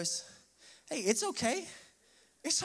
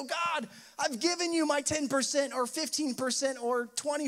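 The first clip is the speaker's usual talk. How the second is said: raised, higher, pleading frustration; frustrated complaint to God; 7 semitones higher, 4 dB louder than usual. Retold or imitated. Believer at a breaking point complaining to God, frustrated. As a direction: vent at God like you're fed up.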